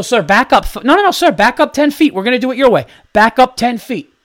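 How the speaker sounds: high, loud, hammed-up command; mock urgent order. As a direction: order him back with exaggerated urgency. Acted ordering the man back.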